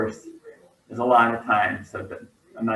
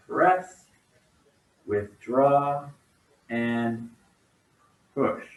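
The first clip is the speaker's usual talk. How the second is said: serene tai chi cues; much slower than usual. Acted. Calling tai chi cues slowly.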